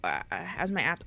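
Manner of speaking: ordinary delivery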